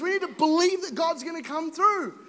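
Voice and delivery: ordinary talk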